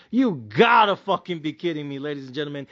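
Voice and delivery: usual speaking voice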